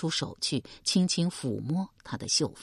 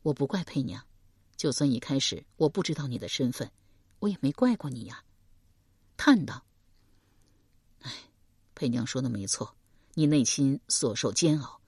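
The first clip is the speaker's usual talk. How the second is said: gentle; 3 semitones lower than usual. Acted. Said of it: "邓毅柔声道".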